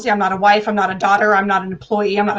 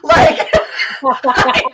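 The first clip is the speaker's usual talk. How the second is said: laughing. Real, not acted. Laughing shout continues.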